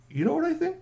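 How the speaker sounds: excited high squeak of a worried friend; squeaky high repeat. Acted. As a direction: jump way up in pitch on the repeat, "You know what I think?".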